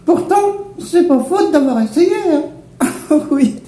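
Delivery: very high squeaky giggle voice; squeaky laughing voice. Acted.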